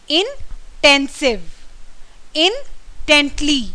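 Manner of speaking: each word slow, stressed syllable punched and lengthened; teacher drills stressed syllables one word at a time. Shown as a demonstration. English teacher demonstrating word stress to students, slow and emphatic. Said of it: "वहाँ पर हमें stress देना चाहिए".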